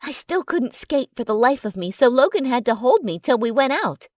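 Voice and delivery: normal talking voice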